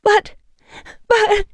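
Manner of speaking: stammering fearful plea; frightened plea. A narrator's reading. Woman pleads with vampire, frightened. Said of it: "I pleaded".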